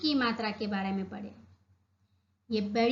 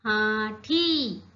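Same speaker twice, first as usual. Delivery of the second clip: हाथी drawn out very slowly; slow spelling out; much slower than usual. Shown as a demonstration. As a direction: stretch थी and हाथी for the class. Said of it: "क्या पढ़ेंगे हम इसे?". (धीरे-धीरे हाथी पढ़ना)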